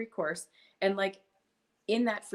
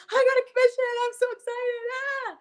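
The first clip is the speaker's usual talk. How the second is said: much higher and louder, gushing with glee; thrilled squeal; 9 dB louder than usual. Retold or imitated. Mom shouting her forty-cent commission to the kids, ecstatic squeal. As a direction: squeal the news like you just won. Celebrating first commission with cartwheels.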